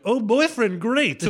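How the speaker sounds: high, loud fake enthusiasm; hammed-up delight, trying too hard to seem fine; overdone approval. Fake over-keen reaction he avoids.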